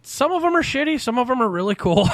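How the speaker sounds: retold noncommittal shrug. Stage crew friend asked about celebrities, noncommittal shrug. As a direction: voice a friend downplaying the glamour. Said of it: "Like, eh, you know".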